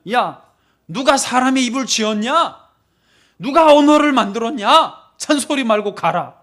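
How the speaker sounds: loud, rising scolding rebuke; acted divine anger, building in volume; annoyed God. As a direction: thunder at a reluctant servant. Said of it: "화가 나셨어요".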